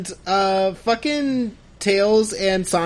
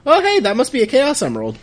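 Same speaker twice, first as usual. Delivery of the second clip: higher, casual mock discovery; offscreen bystander; 6 semitones higher, 4 dB louder than usual. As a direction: lightly act a bystander piping up. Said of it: "someone else from off screen is like".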